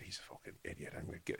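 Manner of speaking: whispered, hushed gossip; whispered aside. Retold or imitated. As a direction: whisper it so the bar can't hear.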